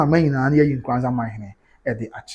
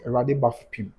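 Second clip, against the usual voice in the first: ordinary talk, nothing marked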